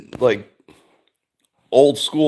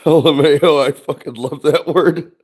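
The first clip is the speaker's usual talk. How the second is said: normal talking voice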